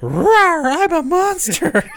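high, slow mock monster growl; monster roar voice. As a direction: growl like a silly little monster. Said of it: "then his penis goes".

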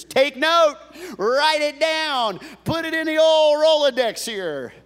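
loud, high preaching of the verse; full-force sermon reading; preaching scripture. Acted. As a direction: proclaim it to the congregation.

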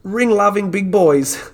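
put-on broad Australian bogan accent, high and loud; hammed-up broad Australian ocker voice. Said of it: "I have to do it in a fucking Bogan voice".

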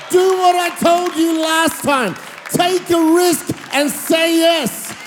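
fervent altar-call sermon shout; full-force preaching. Acted. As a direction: preach it to the back row, slow and fervent. Preaching climax with music.

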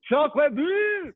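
high, drawn-out mock French yell; hammed-up French accent, screamed slowly; deaf French accent. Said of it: "like, a deaf French accent".

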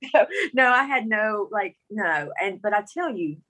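usual speaking voice

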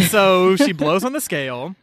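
ordinary talk

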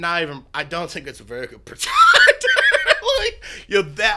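laughs through the words, voice leaping up; laughing. Real, not acted. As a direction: breaks into laughter mid-line. Laughing at the snake talk.

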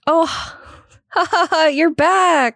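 high, slow forced laugh; mock laughter, drawn out; fake laughing welcome. Everyone greets Weiss, mocking.

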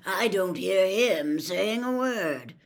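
loud, slow growled retort; deliberate, emphatic growl. A narrator's reading. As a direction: drag it out in a pointed growl. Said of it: "growled Moody".

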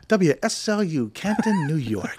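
dad on air, imitated as a mellow radio host; imitating a radio DJ voice. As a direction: lay it on like a late-night jazz host. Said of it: "first introduction to radio voice".

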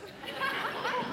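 high breathless laugh; real laugh. Real, not acted. Pastor laughing at his own story, high and airless.

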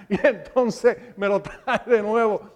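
talking through a laugh; quick words broken up by chuckles. Real, not acted. Preacher amused at his own forgetfulness before the congregation, laughing.

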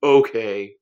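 a loud, fed-up okay; exasperated. Shown as a demonstration. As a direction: sigh it out like you're done. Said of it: "just in an exasperated voice just say".

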